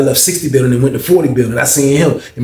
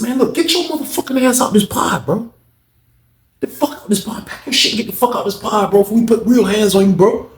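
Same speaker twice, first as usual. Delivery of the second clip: angry threat; 7 semitones higher than usual. Retold or imitated. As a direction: order him out with a threat. Acted quarrel, throwing him out.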